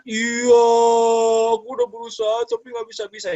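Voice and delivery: high-pitched whining child imitation; upset child's complaint. Voices upset child over homework. (menirukan anak merengek kesal)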